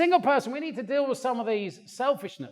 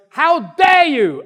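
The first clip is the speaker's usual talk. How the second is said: slow, loud, higher indignant outburst; mock angry wife; 7 semitones higher, 12 dB louder, slower than usual. Preacher imitates angry wife Kerry, loud and slow. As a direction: play the offended wife demanding an apology. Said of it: "Kerry then gets mad at me and goes".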